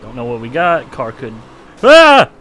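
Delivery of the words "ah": yelling at a horse race. Real, not acted. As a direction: cheer the car on loudly.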